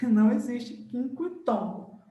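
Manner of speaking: usual speaking voice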